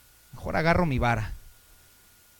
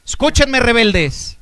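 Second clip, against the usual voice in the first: a higher, loud furious cry at the crowd; angry rebuke; 7 semitones higher, 8 dB louder, slower than usual. Acted. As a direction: lash out like a fed-up leader. Moses explodes at people.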